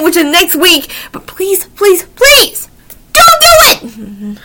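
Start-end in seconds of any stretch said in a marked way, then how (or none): 1.1-2.7 1 pleading. Real, not acted. Pleading with parents not to. 3.1-4.4 1 slow whiny plea. Real, not acted. Pleading with parents not to.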